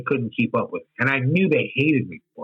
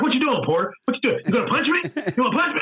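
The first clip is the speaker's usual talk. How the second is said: much higher, laughing tease; mocking taunt; 11 semitones higher than usual. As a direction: laugh at him for trying to punch. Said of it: "He's like".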